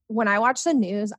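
ordinary talk, nothing marked

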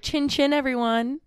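high, affected chin chin; snooty chic toast. Retold or imitated. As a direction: toast like you're too chic. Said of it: "trying to be chic".

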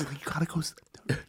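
hushed fast whisper; whispered teacher order. Retold or imitated. Another teacher quietly tells the kids to sit down, whispered. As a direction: whisper it quickly, like a teacher shooing a student. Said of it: "another teacher goes".

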